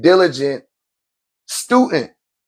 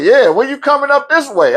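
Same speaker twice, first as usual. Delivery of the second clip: higher, eager ask; light mock of Ricky pressing; imitating Ricky; 7 semitones higher than usual. Ricky pressing the teacher to come, higher. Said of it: "He was like".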